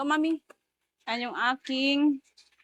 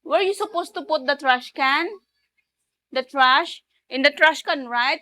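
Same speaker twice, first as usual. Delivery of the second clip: raised, insistent scolding questions; stern parental quizzing; 4 semitones higher, 5 dB louder, slower than usual. Pretend. Parent asking child where trash goes, insistent scolding. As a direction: press the child for the answer, pitched up.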